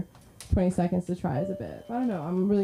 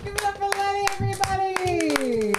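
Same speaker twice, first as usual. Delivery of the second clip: calling for applause; 12 semitones higher than usual. Real, not acted. Host to audience after Lenny's set, calling for applause. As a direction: whoop the crowd up, stretching the last word.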